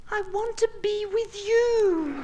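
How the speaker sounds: slow high falsetto, laughing; high giggly confession; girl's voice. Acted. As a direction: coo it slowly like the girl. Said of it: "And she said".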